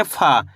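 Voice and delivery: फा with a puff of air, falling low; letter-sound demo. Shown as a demonstration. Teacher answers what we read, letter-sound demo. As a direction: draw out फा, letting it drop. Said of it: "क्या पढ़ेंगे?".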